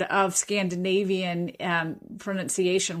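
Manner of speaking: ordinary talk, nothing marked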